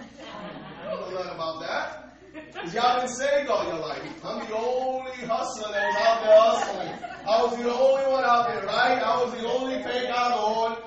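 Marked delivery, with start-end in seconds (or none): 0.1-2.2 laughing. Acted. Repeated teasing line, laughing. 4.2-10.8 raised preaching voice; preaching loud. Real, not acted. Preaching at full force.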